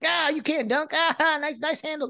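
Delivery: high, mocking trash-talk voice; courtside trash talker. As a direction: needle the player from the sidelines. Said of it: "he's talking all the smack".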